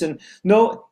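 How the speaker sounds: ordinary talk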